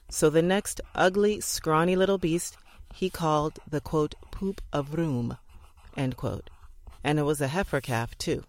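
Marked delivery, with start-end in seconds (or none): 4.3-5.4 slow, soft Scots burr on the name; a hint of a Scots rancher's revenge; mock Scots name. A narrator's reading. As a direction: drag out the name in a lightly mocking Scots voice. Scotsman's mocking calf name.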